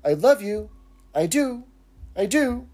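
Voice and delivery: high little doll voice; talking doll. Retold or imitated. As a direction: pitch it up like a toy doll. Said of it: "So the doll speaks. It says".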